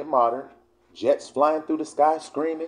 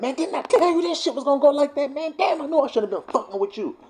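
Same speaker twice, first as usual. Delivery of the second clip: high, put-on voice of griping regret; mock griping Israelite; exasperated complaint. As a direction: gripe like someone fed up. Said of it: "That's how we talk".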